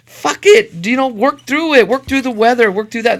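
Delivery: loud, high, pumped-up tough-guy voice; a macho worker shouting to push through. Retold or imitated. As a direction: bark it out like a foreman rallying the crew. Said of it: "A man shit would be like".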